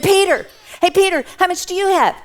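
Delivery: high, loud and quick, calling across a crowd. Acted. Jesus calling out to Peter across the crowd, high and loud. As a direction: shout for Peter, hurried.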